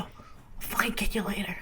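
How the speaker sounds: hushed whispered threat. Retold or imitated. Satan hissing at Gabriel as he flees, whispered threat. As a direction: threaten under the breath. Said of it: "whisper fighting".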